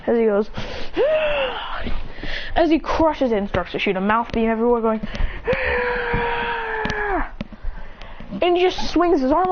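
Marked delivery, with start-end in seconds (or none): 0.5-2.5 high, contained-volume scream; strained high yell; acted beast cry. Fire beast roars. 5.0-8.4 long, high, softened scream; high scream held back in volume; acted beam scream. Beast fires mouth beam.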